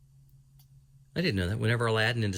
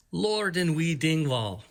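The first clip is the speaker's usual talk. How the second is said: higher, slow put-on Spanish accent; mock Spanish accent; 6 semitones higher, slower than usual. Reading a character's name from the book, in a mock Spanish accent. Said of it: "I shouldn't do a Spanish accent".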